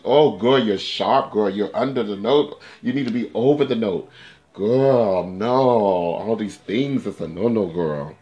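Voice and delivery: loud, exasperated mock telling-off; scolding self-critique. Singer berating own recording, loud mock scolding. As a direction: scold yourself loudly, stretching the big words.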